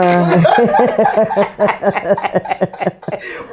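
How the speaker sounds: long burst of loud laughing; hearty, sustained cackle of amusement; loud laughter. Real, not acted. Host joking about guest's busy life, loud laughter. Laughing after her own quote.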